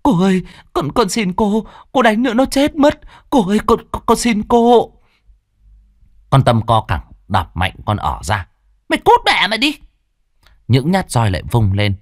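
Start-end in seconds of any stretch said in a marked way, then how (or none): 0.0-4.9 high, loud pleading cry; desperate begging. A narrator's reading. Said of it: "mà gào thét". 8.9-9.8 very high, loud cruel shout; furious snarl. Acted. Kicking the maid away.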